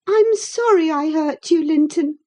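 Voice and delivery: put-on high, pained girl's voice; distressed apology. A narrator's reading. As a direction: apologise as if unable to bear it. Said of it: "racked beyond endurance".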